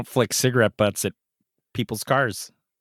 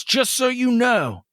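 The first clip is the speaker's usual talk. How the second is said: slow mock cop; 5 semitones higher, slower than usual. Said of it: "so he goes".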